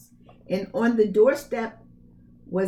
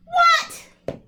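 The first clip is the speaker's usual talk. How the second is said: very high, loud outraged "What"; shocked shriek; 7 dB louder than usual. Acted. Mother hearing about the beans, high shocked shriek. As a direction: shriek in disbelief. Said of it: "said Jack's mother".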